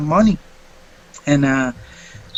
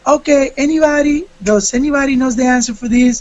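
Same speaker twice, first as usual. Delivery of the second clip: higher, lilting imitation of the teacher; 7 semitones higher than usual. Student retelling teacher asking class, higher camp imitation.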